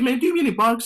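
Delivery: high, loud, incredulous comeback; mock indignant retort. Retelling her reply to the professor, high and indignant. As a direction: fire it back at him like he's crazy.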